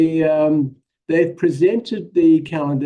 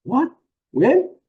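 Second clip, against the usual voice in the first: confused reply; 10 semitones higher than usual. Retold or imitated. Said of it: "I'm gonna say".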